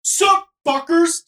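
high, slow, drawn-out bro yell; podcast bro greeting. Retold or imitated. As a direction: hype up the crowd. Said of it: "dude was like".

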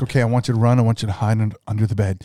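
lightly acted calm dispatcher, low; steady low instructions to a caller. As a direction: play a composed emergency operator. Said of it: "as a dispatcher, you're like".

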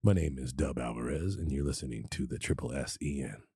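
low, seductive radio voice; sultry. Shown as a demonstration. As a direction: get sultry with the station ID. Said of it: "Just get sultry".

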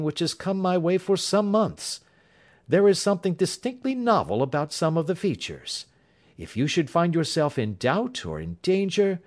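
Holmes, calm and assured. A narrator's reading. Holmes reassures the client.

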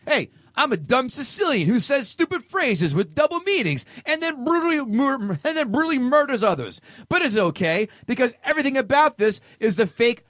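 high, exaggerated sneering read; mock offended reviewer. Host quoting an angry reviewer, sneering. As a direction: sneer through the reviewer's rant. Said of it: "This is how I imagine".

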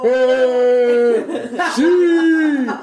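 loud, high football cheer; celebration cheer. Real, not acted. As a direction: cheer the Racing promotion like a goal. Celebran el ascenso del Racing. (grito de celebración futbolera)

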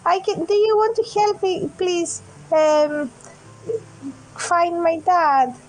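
put-on lost little girl. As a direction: play a scared kid who lost her dad. Opal child voice.

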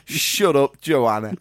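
mock snap. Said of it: "Which one of your mates was like".

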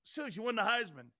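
hushed, mumbled mock greeting from fans; lightly voiced, half-swallowed fan talk; a hint of an admiring fan; imitating fans' greeting. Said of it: "They'd be going like this".

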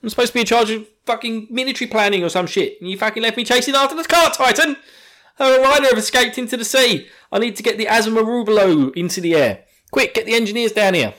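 high, loud frantic yell; Armin shouting. Retold or imitated. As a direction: scream it at Flotch, panicked and furious. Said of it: "And he's just like".

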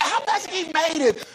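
very high mock-incredulous question; imitated amazement. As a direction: mimic an amazed congregation, pitch way up. Voices the congregation's astonished question.

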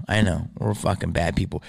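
ordinary delivery

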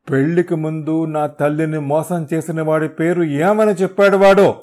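very loud, high, rising roar; roaring shout. Acted. Hero demanding his mother's deceiver's name, roaring shout. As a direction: roar it out to shake the trees. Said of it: "అరుపుకి సరుగుడు చెట్లు కదిలిపోయాయి".

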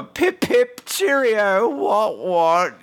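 high-pitched, hammed-up British toff; mock British accent. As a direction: tease them with a caricature British accent. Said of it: "make fun of them like I do with all British people".

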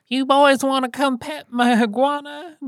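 put-on high, sing-song old woman's offer; the neighbor lady offering the boys a pet of her iguana, sing-song.

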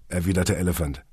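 ordinary delivery, nothing marked

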